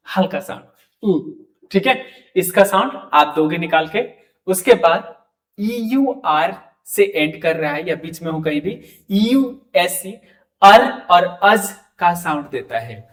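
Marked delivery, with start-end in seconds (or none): none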